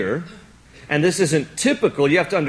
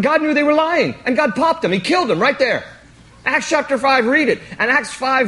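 usual speaking voice

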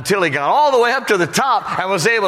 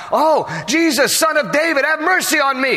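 high, urgent plea to Jesus; pleading cry pitched well above talk; 10 semitones higher than usual. Acted. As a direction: call out high for mercy. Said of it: "So the first time, when he asked".

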